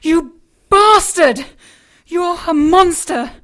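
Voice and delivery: loud slow angry outburst; furious accusation. Acted. Elizabeth raging at Harry for saving her over the girl. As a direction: hurl it at him.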